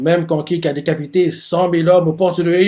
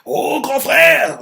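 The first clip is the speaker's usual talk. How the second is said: soldier's cry; 10 semitones higher, 3 dB louder, much slower than usual. Retold or imitated. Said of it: "l'un d'eux fait".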